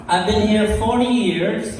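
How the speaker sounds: usual speaking voice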